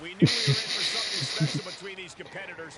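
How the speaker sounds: soft breathy laugh at his own story; exasperated chuckle after the retort; rueful laugh. Real, not acted. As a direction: laugh it off, a little disbelieving.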